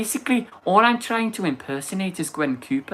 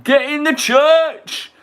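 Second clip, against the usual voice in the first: loud dramatic shout; shouted quote; 12 semitones higher, 9 dB louder, slower than usual. Retold or imitated. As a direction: yell it like the Doctor. Quoting iconic Doctor Who line.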